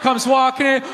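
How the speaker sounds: ordinary delivery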